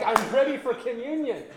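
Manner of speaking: excited preacher voice. Retold or imitated. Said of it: "you're like".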